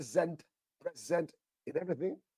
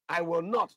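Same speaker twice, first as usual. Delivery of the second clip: I will NOT with NOT hit high and loud; emphatic repetition; 4 semitones higher, 6 dB louder than usual. Shown as a demonstration. Preacher repeating God's promise for emphasis, punching NOT. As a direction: hammer home the word not. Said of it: "Three times, all right, for emphasis".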